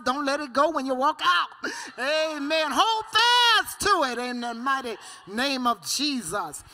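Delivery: loud, rousing church exhortation; loud call-and-response sermon delivery; full-force preaching. Real, not acted. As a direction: preach it out, building to ringing shouts.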